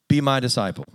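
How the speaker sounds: rising preaching voice, the word hate stretched long; fiery preaching of the verse; scripture read with force. Acted. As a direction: preach the verse, pressing hard on hate and cannot.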